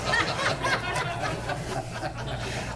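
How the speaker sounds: a high, breathless burst of laughter at his own joke. Real, not acted. After punchline.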